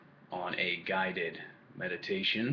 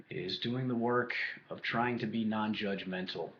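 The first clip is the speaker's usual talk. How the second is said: guided meditation. Acted. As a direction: lead them calmly into the breath, leaving space between phrases.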